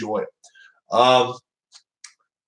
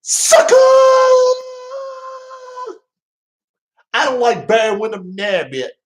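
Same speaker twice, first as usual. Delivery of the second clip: mock wrestler promo, yelled then pitched up; Shaska Watley impression; 3 dB louder than usual. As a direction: ham up a wrestler's trash-talk. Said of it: "do my best Shaska Watley impression".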